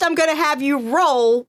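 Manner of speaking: ordinary talk, nothing marked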